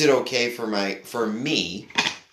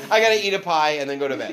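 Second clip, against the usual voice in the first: laughing; 4 semitones higher, faster than usual. Real, not acted. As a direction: rattle it off while cracking up. Laughing at his own high state.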